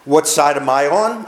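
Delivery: ordinary talk, nothing marked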